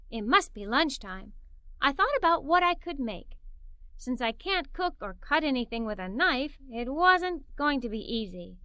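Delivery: ordinary delivery, nothing marked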